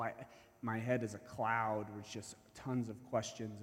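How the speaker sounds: ordinary delivery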